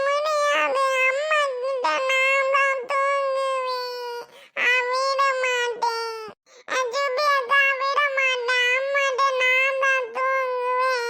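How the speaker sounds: high childish voice, slow wailing fake sobs; a little boy putting on tears to win; fake crying child. Small boy fake-crying to keep his mother's side. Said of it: "நல்லா அழுகுறத மட்டும் கத்துக்கிட்டேன்".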